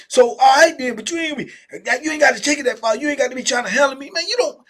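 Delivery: high, loud building challenge; aggressive challenge. Retold or imitated. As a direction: square up and threaten him. Old head escalating, aggressive.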